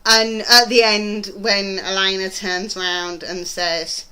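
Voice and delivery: normal talking voice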